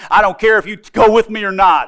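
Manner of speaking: high, loud defiant declaration; she's done waiting, say it loud; determined. Retold or imitated. Woman defying the deacon, high and loud resolve. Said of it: "She said".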